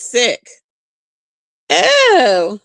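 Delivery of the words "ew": disgusted ew. Real, not acted. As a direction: recoil in disgust at the thought. Reacting to licking story.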